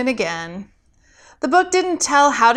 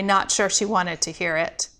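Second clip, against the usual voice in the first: plain reading voice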